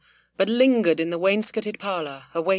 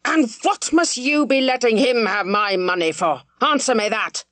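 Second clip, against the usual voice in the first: attacking again; 3 semitones higher than usual. A narrator's reading. Squire attacking Godfrey over the money, angry demand. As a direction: go at him again with heat. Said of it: "attacking Godfrey again".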